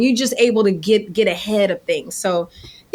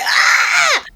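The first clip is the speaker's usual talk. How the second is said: high mock scream of dismay; mock shriek; 3 dB louder than usual. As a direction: squeal in fake horror. Reaction seeing hashtag.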